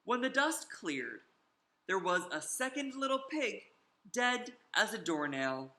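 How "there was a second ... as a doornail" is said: ordinary delivery, nothing marked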